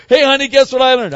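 high, loud and fast, bursting to share; eager greeting. Acted. Husband home from retreat calling eagerly to wife. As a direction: rush in to tell her.

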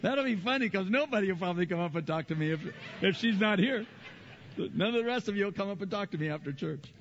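high, fast, laughing tease; joking aside. Real, not acted. Pastor joking with the congregation, laughing. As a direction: tease the crowd with a chuckle.

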